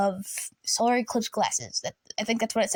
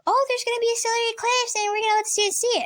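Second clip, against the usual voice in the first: mock announcement; 11 semitones higher, much faster than usual. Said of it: "we got news of".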